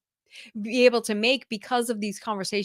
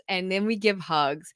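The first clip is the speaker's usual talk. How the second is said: ordinary delivery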